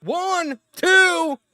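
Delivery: shouting the big spot, high and slow; excited shout. Real, not acted. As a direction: yell it like a live call. Live reaction to a spear.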